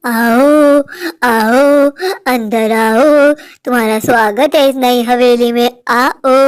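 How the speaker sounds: low, drawn-out ghostly summons; deep haunted-mansion ghost voice; luring ghost. Acted. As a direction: beckon her in slowly, stretching every word. Ghost lures her inside.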